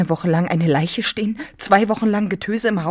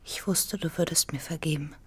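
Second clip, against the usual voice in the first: much softer, fading into a whisper; hushed; 11 dB quieter than usual. Acted. Anna murmuring to Manao, hushed and soft. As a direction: breathe it out, barely voiced.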